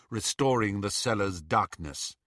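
usual speaking voice

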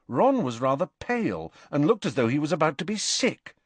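plain reading voice

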